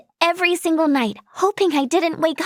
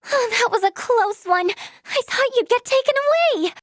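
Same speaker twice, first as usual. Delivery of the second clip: shaken relief; 7 semitones higher than usual. Acted. Relief after friend's close call.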